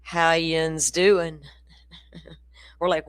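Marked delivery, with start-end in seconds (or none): 0.0-1.4 slow, stretched-out Appalachian Tennessee drawl; Tennessee mountain drawl. Retold or imitated. Tennessee shopkeeper greeting Florida girls, in a slow, lazy drawl. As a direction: drag every word like the sloth-slow mountain man. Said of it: "He says".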